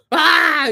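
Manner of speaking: sudden high, loud startled shriek; mock startled scream. Drivers finding out the biker is a guy, mock startled scream. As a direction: shriek like you just got a nasty shock. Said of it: "and they'll go".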